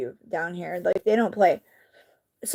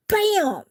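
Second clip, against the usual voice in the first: a sudden high "Bam!" for the impact; crash noise; 10 semitones higher than usual. Retold or imitated. Narrating the crash to viewers, high mock bang. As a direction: mimic the truck slamming in. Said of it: "he goes".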